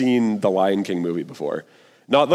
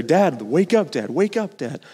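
pleading cub; 6 semitones higher than usual. Retold or imitated. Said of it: "I was crying about this".

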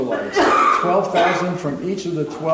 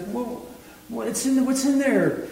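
high, wondering whoa rising into a question; awed amazement; 8 semitones higher than usual. Retold or imitated. Daniel staring at the unopened scroll, high awed wonder. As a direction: gasp in wonder at the sealed scroll. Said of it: "Daniel starts crying, and he's like".

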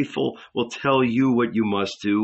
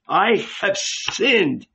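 higher and slow, "I have sinned" drawn out in anguish; anguished cry; 7 semitones higher, much slower than usual. Retold or imitated. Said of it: "and cry".

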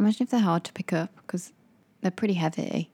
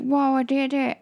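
lightly put-on funny voice of an underwhelmed gamer; a mild mock voice, higher, for the speedrunner; funny impression voice; 7 semitones higher than usual. Mocking the speedrunner's flat reaction to his record, a light funny voice. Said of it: "I did that funny voice".

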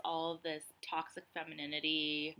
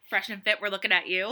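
louder, laughing call-out; loud, amused jab at the podcast hosts; teasing callout; 2 semitones higher, 9 dB louder than usual. Real, not acted.

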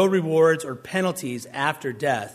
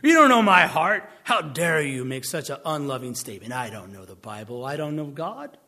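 mock offended critic; 5 dB louder than usual. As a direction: play a huffy tone policeman taking offence. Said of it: "They would say".

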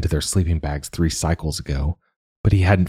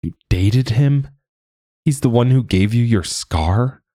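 can't believe she dated him; shocked disbelief; 6 semitones higher than usual. A narrator's reading. Character's shocked question.

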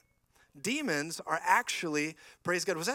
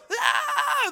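very high, loud shriek; a frightened first-timer's cry; startled scream; 9 dB louder than usual. Retold or imitated. Newcomers screaming at the deliverance, loud shriek.

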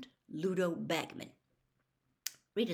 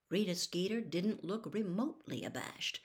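usual speaking voice

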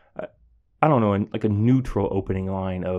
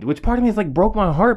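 heartbroken outburst; 11 semitones higher, 5 dB louder, faster than usual. Real, not acted. Teacher telling how the student's thanks moved him, pitched much higher. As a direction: voice jumps much higher and louder.

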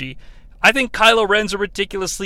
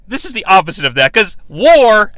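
ordinary talk, nothing marked

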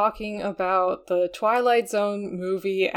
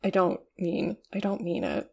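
fading apology; 6 dB quieter than usual. Real, not acted.

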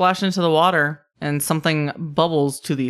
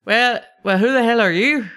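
higher, challenging attempt at Scottish; kobold Scottish brogue; 7 semitones higher, 4 dB louder than usual. Kobolds challenge the newcomers, raised Scottish brogue. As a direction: confront strangers in a rough-and-ready Scottish brogue. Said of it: "Scottish brogue".

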